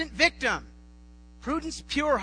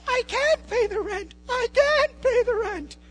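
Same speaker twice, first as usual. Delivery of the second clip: high shrill falsetto damsel, slow and pleading; distressed damsel; 12 semitones higher, 6 dB louder, slower than usual. Acted. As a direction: wail like a helpless heroine. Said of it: "Bowtie Melodrama".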